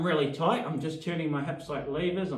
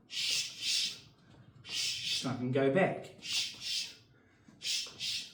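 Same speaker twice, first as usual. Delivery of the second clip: sharp punch exhales; 4 dB quieter, slower than usual. Shown as a demonstration.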